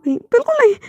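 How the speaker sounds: high embarrassed no with falling end; flustered refusal. Acted. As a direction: yelp it, mortified.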